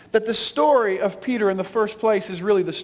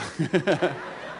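laugh; 5 semitones lower, 5 dB quieter than usual. Real, not acted. Laugh after grandmother quote.

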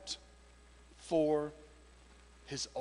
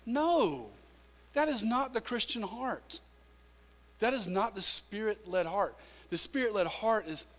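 preaching; 9 semitones higher, 5 dB louder than usual. Acted. Pastor preaches to the family about weeping with others, rising and loud. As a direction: drive it home from the pulpit.

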